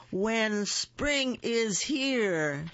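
loud, high and slow joyful shout. A narrator's reading. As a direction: call it out slowly to the whole forest. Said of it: "shouted the Bear".

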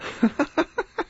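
shrill, manic high laugh; high unnatural laugh. A narrator's reading. Lombard bursts out at the dead judge with a high unnatural laugh. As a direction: laugh too high, unhinged. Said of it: "a high, unnatural laugh".

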